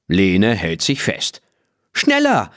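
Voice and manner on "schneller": hoch und begeistert gerufen; begeisterter Ruf. A narrator's reading. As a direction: treib das Pony jubelnd an. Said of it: "ruft sie". (jubelnder Ruf, viel höher)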